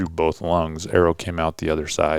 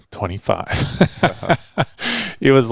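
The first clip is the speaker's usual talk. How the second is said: laughing retell; 7 semitones higher, much slower than usual. Retold or imitated. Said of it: "I just kept whispering".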